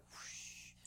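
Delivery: very soft, voiceless sliding noise; whispered whoosh. Retold or imitated. Showing how they take that little thing, whispered whoosh. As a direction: imitate a stone gliding away in a whisper. Imitates curling stone sliding.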